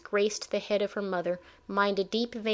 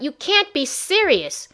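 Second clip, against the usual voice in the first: higher, louder and slow, outraged; furious protest; 7 semitones higher, 6 dB louder, slower than usual. Acted. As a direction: let the outrage burst out. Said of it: "She stomped across the room".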